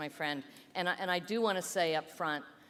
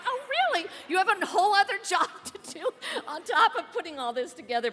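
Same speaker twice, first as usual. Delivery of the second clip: high-pitched incredulous retelling, laughing midway; squeaky-high amused surprise; mock astonishment; 12 semitones higher, 4 dB louder, faster than usual. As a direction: act out disbelief, high and quick. Retells own astonished reaction, laughing.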